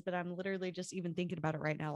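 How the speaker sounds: usual speaking voice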